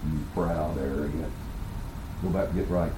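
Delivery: low, hushed inner rebuke; Holy Spirit. Acted. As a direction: speak very low and quiet, like conscience reproving. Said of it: "the Holy Spirit saying".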